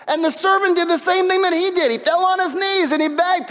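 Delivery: high, loud, impassioned preaching; preaching at full force. Acted. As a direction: build the story into a fiery sermon peak. Preacher builds to climax.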